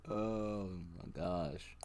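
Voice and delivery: soft, slow, drawn-out oh; slow disbelief. Real, not acted. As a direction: drag it out, quiet and stunned. Disbelief at the chart.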